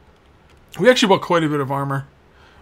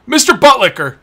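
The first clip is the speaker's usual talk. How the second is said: teasing call; 8 semitones higher, 9 dB louder than usual. Acted. Teasing a game character.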